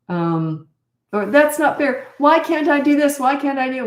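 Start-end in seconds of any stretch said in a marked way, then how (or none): 1.3-3.8 put-on high, loud childish complaint; whiny protesting kid. Child protesting to parent about what's not fair, high whine. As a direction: whine it like a kid denied something.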